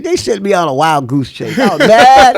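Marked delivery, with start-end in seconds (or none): 1.6-2.4 much higher and louder mock anger; mad. Angry that the tap place was closed, hammed-up fury. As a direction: blow up at the memory.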